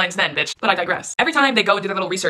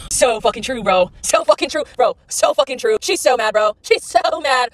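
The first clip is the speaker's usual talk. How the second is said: higher hyped-up bro voice; impression of male commenters; 4 semitones higher, slower than usual. Men cheering each other in her comments, mocking bro impression. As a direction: pile on like bros egging each other on, pitched up. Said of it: "This is my impression of what I think men".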